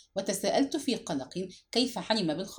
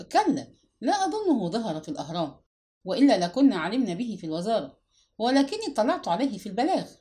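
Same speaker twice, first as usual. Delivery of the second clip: ordinary delivery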